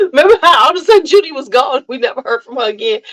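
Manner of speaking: high, quick, giggly recollection; amused, laughing. Real, not acted. Reminding viewers of a vanished TV character, laughing. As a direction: rattle it off through laughter.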